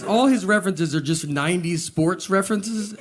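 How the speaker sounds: ordinary delivery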